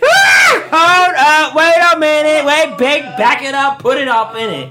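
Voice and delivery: loud, high, laughing call-out; booming tipsy uncle. Pretend. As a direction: boom it out like a rowdy uncle. Echoing her friend loudly.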